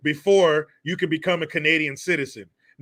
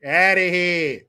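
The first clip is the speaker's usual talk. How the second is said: louder, stretched-out send-off; drawn-out dismissal; 4 dB louder, slower than usual. Acted. Sending the kid away, loud drawn-out dismissal. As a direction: hold the last word long and strong.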